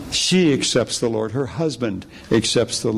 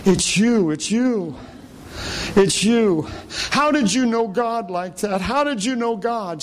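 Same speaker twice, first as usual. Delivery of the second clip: high-pitched retelling of an injured woman's awe; higher voice for the hurting woman; woman in pain; 9 semitones higher than usual. Retold or imitated. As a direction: imitate an amazed patient, pitched well up. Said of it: "and she's in such pain. She says".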